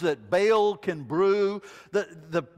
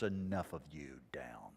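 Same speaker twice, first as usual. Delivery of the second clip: much softer, low and firm; quiet command; 14 dB quieter than usual. Acted. God speaking to the chaotic waves, quiet and firm. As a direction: settle the chaos with a soft word. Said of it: "the God who stands ... and says".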